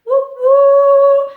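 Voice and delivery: very high, very loud sung woo; sung train whistle. Pretend. Reading to children, a very high loud whistle. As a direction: sing out a steam whistle.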